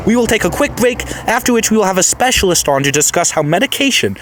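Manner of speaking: upbeat radio presenter voice; radio host. Acted. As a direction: sign off the segment like a radio host. Radio host wrapping up the segment.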